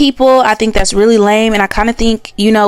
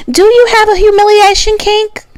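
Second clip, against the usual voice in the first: high, louder teasing title call; announcing segment title; 10 semitones higher, 3 dB louder than usual. Acted. Naming her new segment for viewers, high and teasing. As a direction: sing out the segment name like a tease. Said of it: "I'm gonna call this portion".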